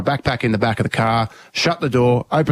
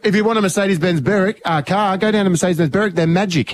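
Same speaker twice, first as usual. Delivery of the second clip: mock ad plug; 6 semitones higher than usual.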